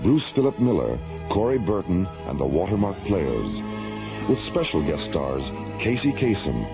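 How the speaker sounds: usual speaking voice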